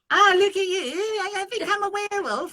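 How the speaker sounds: hammed-up English accent, high and loud; mock English accent. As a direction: overdo a posh English accent. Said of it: "If you were like".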